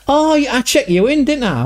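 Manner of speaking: high, put-on Scottish-Irish accent; Jerry's Celtic accent. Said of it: "the same guy, Jerry".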